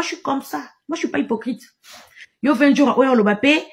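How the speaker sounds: usual speaking voice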